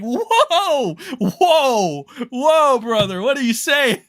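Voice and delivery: loud, high-pitched disbelief; hammed-up alarmed protest; acted shock. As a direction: halt someone with big, stretched whoas. Acting out shocked reaction to claim.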